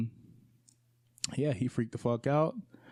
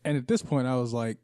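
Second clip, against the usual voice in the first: ordinary delivery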